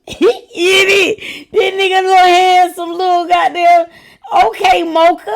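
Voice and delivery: high, loud, laughing gush; giddy gushing. Real, not acted. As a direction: swoon over him, laughing. Gushing over man in clip.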